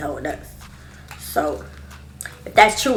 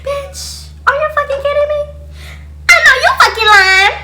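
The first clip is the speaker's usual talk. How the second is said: very high screaming shout with a laugh; shrieking disbelief; 10 dB louder than usual. Retold or imitated. Screaming at the cop in disbelief. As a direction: scream that it can't be true.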